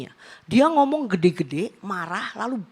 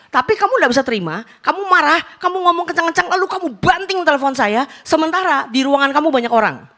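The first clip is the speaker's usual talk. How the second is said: louder, higher indignant reproach; indignant scolding; 5 semitones higher, 5 dB louder than usual. Retold or imitated. Preacher tells the congregation how he wanted to scold his colleague, indignant. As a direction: scold him as if he stood in front of you.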